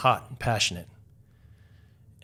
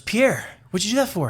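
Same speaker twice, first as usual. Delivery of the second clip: high mock Maria voice; 11 semitones higher, 7 dB louder than usual. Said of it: "stands up and goes".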